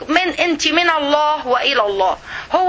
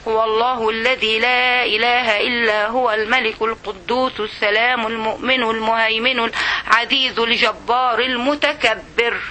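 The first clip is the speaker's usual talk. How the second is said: slow, low, measured chant; Quran recitation; 4 semitones lower, slower than usual. Acted. Teacher reciting a verse to her class, slow low recitation. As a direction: draw out the vowels in reverent recitation.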